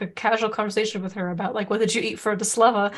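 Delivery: normal talking voice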